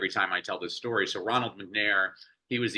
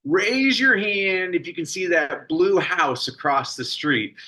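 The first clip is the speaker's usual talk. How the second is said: lightly voiced teacher, a bit higher and bright with purpose; teacher addressing class; 7 semitones higher, slower than usual. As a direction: call the class like a lively schoolteacher.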